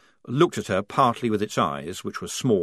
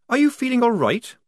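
put-on higher young soldier voice; Polly voice; 13 semitones higher, 5 dB louder than usual. A narrator's reading. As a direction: voice the young recruit.

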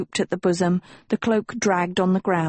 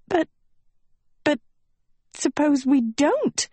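anxious stammer; 8 semitones higher than usual. Acted. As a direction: stammer it out in panic. Sophie panics before trial.

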